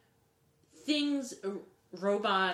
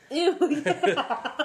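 high, loud mocking "ew"; 8 semitones higher, 9 dB louder than usual. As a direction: squeal a disgusted ew. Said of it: "a button with his voice on there that says, 'Ew.'".

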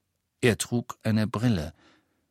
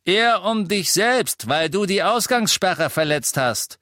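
loud, high retort; raised-voice warning; 10 semitones higher, 5 dB louder than usual. A narrator's reading. Innkeeper and Death Eaters quarrelling over curfew, loud warning. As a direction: throw the warning back loudly.

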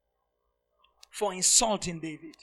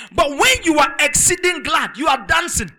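full-force preaching; 4 semitones higher, 10 dB louder than usual. Acted. Preacher driving the point to his flock, loud and high. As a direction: pull the whole church up with you.

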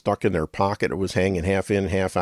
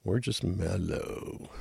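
mock mellow; 11 dB quieter, much slower than usual. Joking about docile people.

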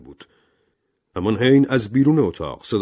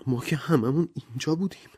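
trembling voice; 9 semitones higher than usual. A narrator's reading. Frightened woman insists it was none of them, trembling. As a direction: protest in a high, fearful voice. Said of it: "ورا با صدای لرزانی گفت".

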